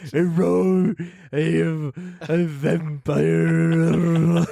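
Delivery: very slow, drawn-out mock vampire; High Horse. Co-host taking a turn at the vampire, badly hammed-up impression. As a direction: stretch each word into a silly vampire impression. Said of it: "can I try High Horse now?".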